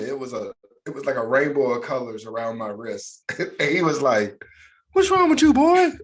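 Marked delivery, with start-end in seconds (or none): 4.9-5.9 much higher, louder hammed-up dad shout; stern dad. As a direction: yell it like a startled father. Dad voiced in retold story.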